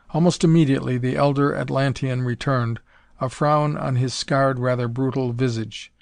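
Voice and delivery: ordinary delivery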